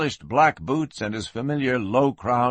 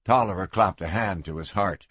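usual speaking voice

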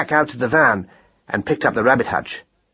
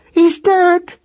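very high, loud cry of despair; wailing; 5 dB louder than usual. A narrator's reading. Mother believing her son is dead, high despairing wail. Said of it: "she wailed".

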